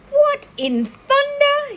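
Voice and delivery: high, loud, slow outraged exclamation; startled farmhand. Acted. Lurvy hit by the rotten smell, high, loud and startled. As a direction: recoil at the reek.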